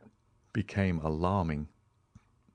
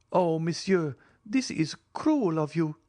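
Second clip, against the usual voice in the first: affected; 9 semitones higher, 3 dB louder than usual. Acted. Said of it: "much affected".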